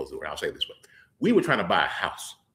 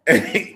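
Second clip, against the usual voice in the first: short laugh; 5 semitones higher, 8 dB louder than usual. Real, not acted. Amused at Peter's doubt.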